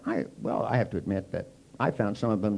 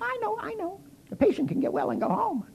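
much higher, keen know-it-all; eager woman. Retold or imitated. A woman in the group eagerly shouting the answer. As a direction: blurt it out like the class swot. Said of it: "this woman went".

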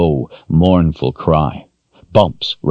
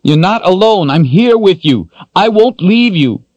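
desperate loud reassurance; 5 dB louder than usual. Acted. Richard desperately reassuring her.

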